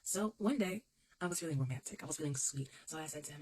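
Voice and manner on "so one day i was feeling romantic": ordinary talk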